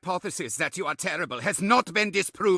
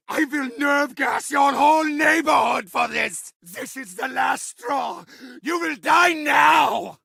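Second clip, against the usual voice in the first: higher, shouting villain rage, spitting every threat; furious ranting villain; 3 semitones higher than usual. Acted. Villain raging at players who keep surviving, furious shouting. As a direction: scream threats at the worms who won't die.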